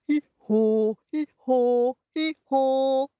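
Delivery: held haw on every bray; donkey bray. Acted. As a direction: bray like a keen lead singer. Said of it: "I'll be the lead singer".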